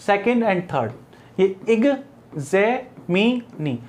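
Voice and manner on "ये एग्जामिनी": Examinee said very slowly, syllable by syllable; stress demo. Shown as a demonstration. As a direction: drag out examinee to show where the stress falls. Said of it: "इसमें जो stress है यहां पर है". (Examinee को धीरे-धीरे, stress दिखाते हुए)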